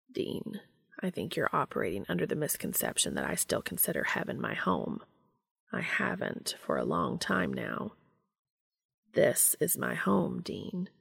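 soft and measured, lingering on the words; Cass. Acted. Cass telling Dean he is home, quiet and earnest.

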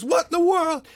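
high, loud alarmed shout from the back seat. Retold or imitated.

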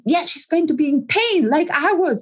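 mildly acted comeback; defiant client. Client insists in retold exchange.

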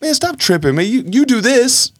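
mocking defensive husband; dismissive retort. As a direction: wave her complaint away. Dismissing wife's hurt feelings.

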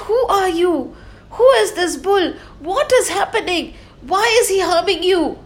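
high, urgent questioning; concerned king. Acted. King Parikshit urgently questioning the cow, high and anxious. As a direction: ask quickly and anxiously.

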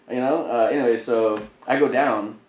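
normal talking voice

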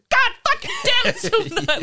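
imitated high, loud outburst; crazy southern rage. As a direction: flip into hammed-up southern fury. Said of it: "crazy and southern".

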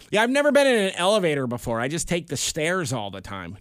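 high, fast, jokey sarcastic aside; mock sarcastic voice. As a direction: play it like a silly fake boast. Joking after elevator slip.

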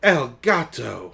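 slow loud dramatic call; cried out. Acted. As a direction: call the name out slowly. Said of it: "Nancy and Carla cried out".

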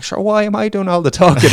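high, loud taxi-driver outburst; mock protest.

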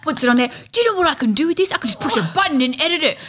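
high, fast, thrilled unboxing voice; excited gushing. Retold or imitated. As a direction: gush like it's Christmas morning.

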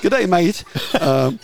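mock Aussie manager on the phone; Australian accent. The manager's Australian accent, lightly done. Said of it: "in his Australian accent".

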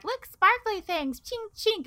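high, slow little-creature voice; squeaky and awed, pointing at treasure; cute penguin voice. Retold or imitated. Penguin voiced in retold story.

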